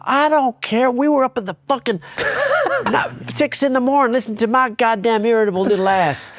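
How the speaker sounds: high, far-off drill-sergeant bark; Sarge yelling. Retold or imitated. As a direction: bark it like a furious wrestling coach. Sarge ranting.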